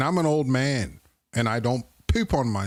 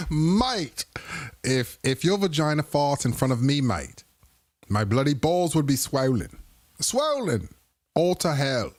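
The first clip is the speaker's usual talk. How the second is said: mock British accent. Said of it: "I think I'm mixing British with Australian".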